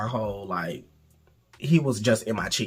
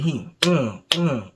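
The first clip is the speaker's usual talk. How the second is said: usual speaking voice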